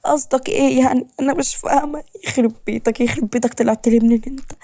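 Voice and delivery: high-pitched anxious protest; fearful, nervous. Acted. Woman cornered by her new husband, fearful and tense. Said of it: "روان بخوف وتوتر".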